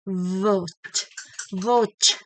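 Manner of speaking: Vote begun with a buzzing V, never a W glide; V not W. Shown as a demonstration. Teacher warns against W, models V. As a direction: bite the lip for Vote. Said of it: "always v".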